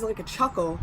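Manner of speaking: normal talking voice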